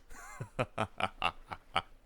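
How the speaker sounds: a knowing private chuckle; sly chuckle. Acted. Boasting of the New World.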